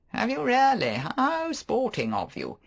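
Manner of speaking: teasing, overdone surprise; Favell. Acted. Favell voiced.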